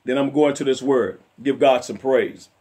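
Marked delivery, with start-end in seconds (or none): none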